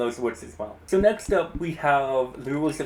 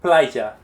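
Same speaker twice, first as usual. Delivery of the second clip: Playa called out louder as PLA-ya; first-syllable stress; 7 dB louder than usual. Shown as a demonstration. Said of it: "I'm going to say a word".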